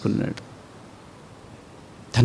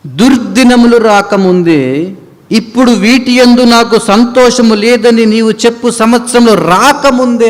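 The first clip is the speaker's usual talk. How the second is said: higher, louder slow proclamation; preached scripture; 10 semitones higher, 7 dB louder than usual. Acted. Preacher reading Ecclesiastes to the church, loud preaching. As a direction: ring the verse out.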